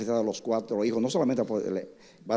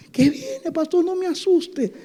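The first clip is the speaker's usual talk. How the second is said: put-on much higher, frightened little girl voice; scared little girl; slower than usual. Girl begs the pastor not to scare her, high and frightened. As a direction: squeak like a frightened child pleading.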